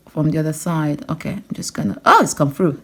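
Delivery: ordinary delivery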